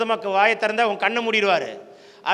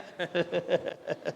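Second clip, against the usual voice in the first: low, soft chuckle; quiet laugh to himself at a silent crowd; amused low chuckle; 3 semitones lower, 9 dB quieter than usual. Real, not acted. Preacher chuckles at silent congregation.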